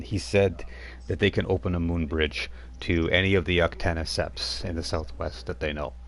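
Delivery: usual speaking voice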